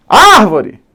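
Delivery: high, loud call punching ÁR; shouted at the gate. Shown as a demonstration. Teacher warned about volume, shouting at the gate. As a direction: yell "árvore" as if calling someone outside. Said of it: "O famoso grita no portão".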